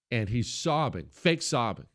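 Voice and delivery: ordinary talk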